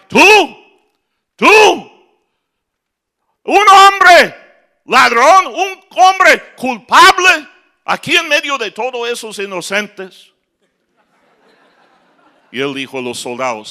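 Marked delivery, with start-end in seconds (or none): 0.1-10.3 high, loud mock-furious shout; feigned angry yell. Duke pretends fury, shouting at the honest prisoner. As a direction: bellow at him in fake anger. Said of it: "fingiendo que era enojado, empezó a gritarle".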